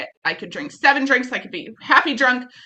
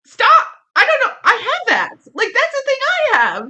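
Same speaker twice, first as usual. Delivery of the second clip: excited outburst; 12 semitones higher than usual. Retold or imitated. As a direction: blurt it out, thrilled to finally understand. Retelling her excited outburst in meeting.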